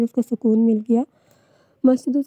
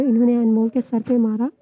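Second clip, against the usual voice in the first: plain reading voice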